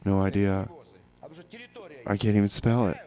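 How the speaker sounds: ordinary delivery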